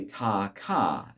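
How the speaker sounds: ta ka said very slowly, each syllable separate. Shown as a demonstration. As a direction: spell out ta ka slowly for a student. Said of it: "So say".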